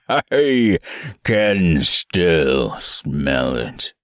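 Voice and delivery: very low, slow, sniffing menace; beast voice. Acted. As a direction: savor each word like a predator. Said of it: "said the beast".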